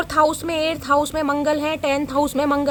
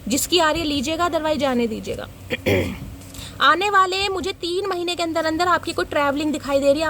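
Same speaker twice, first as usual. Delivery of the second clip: normal talking voice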